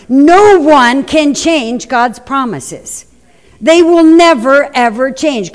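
slow, stressed "never, ever"; emphatic declaration. Real, not acted. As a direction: hammer home never ever. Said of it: "preacher emphasizing promise".

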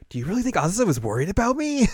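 high, quick put-on girlish question; lightly hammed-up wonder; touched anime girl. Yui asks, retold.

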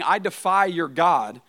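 slow, loud, drawn-out taunt; defiant giant. Retold or imitated. Goliath taunting the army of God, slow and loud. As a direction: spell out every word as a challenge. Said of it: "He was saying".

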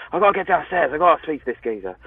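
mildly frantic eagerness, high then settling; eager. Retold or imitated. Aspiring actor to himself about meeting a director, eager. Acting his eager younger self.

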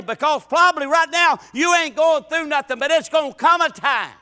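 loud, high, rhythmic preacher's cry; full-force preaching. Acted. Preacher at full force to the congregation. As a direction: drive the point home to the church.

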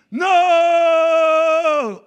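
long, high, very loud scream of refusal. Retold or imitated. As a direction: scream it out as the voice bursting from someone's mouth. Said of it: "out of my mouth came a man's voice screaming".

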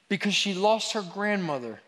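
usual speaking voice

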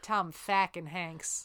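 FUCKING said as "fackin", drawn out slowly; slow "Tom FACKIN Hanks", flat A in fucking; altered pronunciation. Pretend. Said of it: "You have to spell it weird, like F A C K A N".

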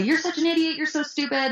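high-pitched mock taunting; super mean. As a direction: play the mean girl hurling insults. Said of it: "be super mean to me".

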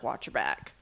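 low, hushed threat; quiet menacing. Retold or imitated. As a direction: drop it low and ominous. Retold threat.

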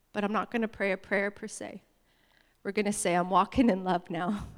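low, soft, solemn voice; hushed prayer leading. Real, not acted. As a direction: quietly lead them into a holy moment. Leads a hushed moment of prayer.

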